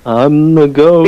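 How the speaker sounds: slow, drawn-out ghost voice; haunt them with long, stretched-out words; phone ghost. Pretend. Prank caller plays phone's ghost.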